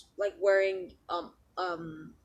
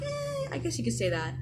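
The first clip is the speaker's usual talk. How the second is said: noncommittal hedge; 10 semitones higher than usual. Retold or imitated. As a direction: imitate a half-hearted yes. Noncommittal answer, high drawn-out eh.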